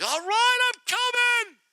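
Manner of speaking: high, loud mock-exasperated call; hammed-up huffy reply. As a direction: throw it out like you're fed up. Grudging reply to the page.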